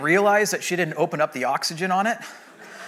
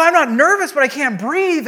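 higher, louder breathless protest; out-of-breath protest; 8 semitones higher, 4 dB louder than usual. Retold or imitated. Patient to nurse after mask comes off, panicky protest. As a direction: insist urgently, short of air. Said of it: "I'm, like, out of breath".

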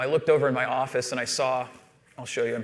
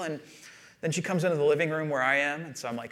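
usual speaking voice

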